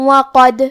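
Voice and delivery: loud demo of وقد with bouncing د; qalqala on dal. Shown as a demonstration. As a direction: say وقد, make the د echo. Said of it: "تو ہم یہ حرف کو ہلاتے ہوئے یہ کلمہ کو پڑھیں گے".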